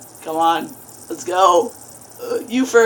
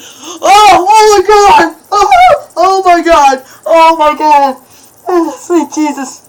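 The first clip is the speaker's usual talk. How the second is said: high, loud, repeated cries of terror; terrified screaming; 9 semitones higher, 10 dB louder, slower than usual. Real, not acted. Player turns and sees the monster, terrified screaming. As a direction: shriek it over and over. Scared by looking behind.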